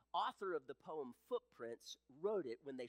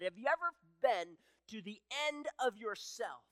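much higher and louder, pleading and proclaiming; full-force preaching; 10 semitones higher, 11 dB louder than usual. Acted. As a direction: preach at full voice, crying out the prayer. Preaching climax with voiced prayers.

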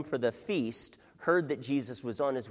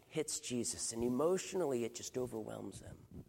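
somber hushed grief; 5 semitones lower, 7 dB quieter than usual. Real, not acted.

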